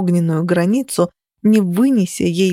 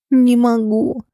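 a pained higher moan; 5 semitones higher, slower than usual. A narrator's reading. Heroine refuses Phoenix in a pained moan. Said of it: "простонала я".

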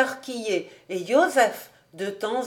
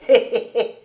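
loud, pleased "hé, hé, hé" laugh; gleeful chuckle; 3 semitones higher, 5 dB louder than usual. A narrator's reading. Old David relishes wine costing him nothing, gleeful chuckle. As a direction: chuckle over the winnings in advance.

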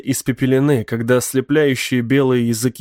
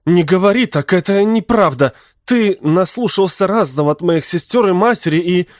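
upset, raised angry voice; 6 semitones higher, 3 dB louder than usual. A narrator's reading. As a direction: snap back, hurt and angry. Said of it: "раздался гневный голос Сильвии". (гневно, на повышенных тонах)

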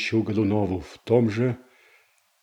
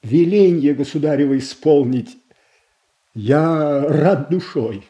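fervent vow; 4 semitones higher, 5 dB louder than usual. Acted. Shuisky answers the tsaritsa.

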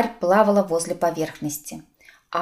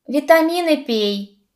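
higher, drawn-out admonishment; stern advice; 7 semitones higher than usual. A narrator's reading. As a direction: prescribe it slowly and strictly. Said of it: "строго посмотрел на него Нагас". (строгое наставление)

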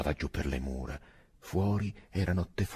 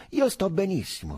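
curt; 3 dB louder than usual. A narrator's reading. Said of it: "fece asciutto Angustina".

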